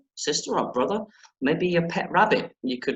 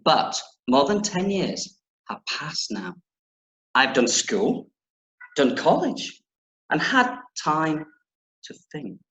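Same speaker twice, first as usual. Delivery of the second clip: deliberate, emphatic reading with dramatic pauses; story narration. Acted. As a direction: read a confession to children, weighing key phrases. Reads the book aloud.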